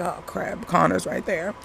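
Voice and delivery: caught-out mutter. Retold or imitated. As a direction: hush it like she's just been caught. Mom caught, sneaking a look.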